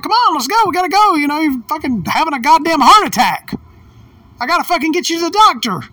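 high, frantic retold call through a door; worked-up re-enactment of calling out; urgent yelling. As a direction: hurry him out, pitched high and pushing. Retells shouting while banging on door.

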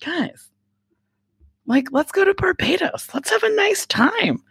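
high, coaxing voice begging to go on holiday; high eager plea to a hard-working family; pleading cheerfully. Retold or imitated. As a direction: coax them like a kid begging for a trip. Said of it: "And I'm like".

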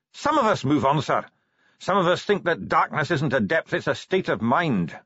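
light, high dwarf voice; cheerful dwarf. Acted. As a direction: pitch it up as an affable dwarf.

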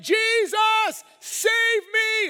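blind beggar's full-force cry; very high, desperate shouted plea; yelling. Acted. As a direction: scream it out over those silencing you. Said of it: "Stop your yelling".

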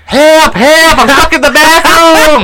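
high, loud, drawn-out yell; mock cry for help. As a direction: holler it across the room to embarrass someone. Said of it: "Looked at him yelling".

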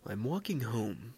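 slow, soft, put-on narrator voice; storytelling voice. Shown as a demonstration. Man starting a scary story, hammed-up storytelling voice. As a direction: draw it out softly like a campfire storyteller. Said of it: "I gotta put on a storytelling voice".

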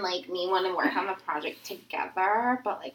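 put-on soft, rising valley girl uptalk; mock a ditzy valley girl student. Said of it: "sounding like a valley girl".

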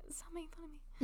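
creaky whisper; breathy creak, mocking tone; whispered vocal fry. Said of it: "I'm doing my own vocal fry".

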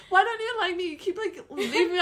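high-pitched whine with a laugh; mock pleading. Imitating drunk suitor being left, mock pleading.

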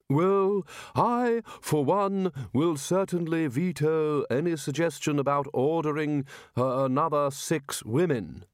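high, slow, emphatic veto; firm. A narrator's reading. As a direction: lay it down firmly, drawing words out. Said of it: "said the chair firmly".

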